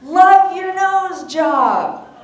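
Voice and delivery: high, loud and slow taunting call; mocking loudmouth yell; retold yell. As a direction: drag it out like a bully jeering. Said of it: "he yells out".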